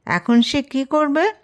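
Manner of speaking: usual speaking voice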